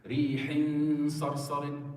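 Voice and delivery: slow recitation, the nasal ending of ريح held long; lingering on tanween. Shown as a demonstration. Teacher shows students where to spend time, lingering on the tanween. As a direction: dwell on the tanween in ريح before moving on to صرصر. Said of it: "Where did I spend my time?".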